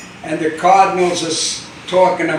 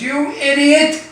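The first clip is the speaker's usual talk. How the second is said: high, louder, exasperated scolding; wife scolding her husband, high and loud; 8 semitones higher, 4 dB louder than usual. Acted. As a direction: chew him out, pitched way up. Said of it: "She says".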